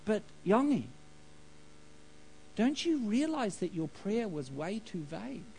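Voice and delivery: softened, voiced divine whisper; gently hushed reproach, as if from God; retold whisper. God chiding a man over a vague prayer, in a retold whisper. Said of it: "And the whisper said".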